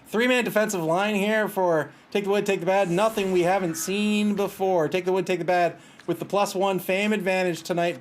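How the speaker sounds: lively match-caller delivery; play-by-play. Real, not acted. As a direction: announce the line-up like a sportscaster. Live game commentary.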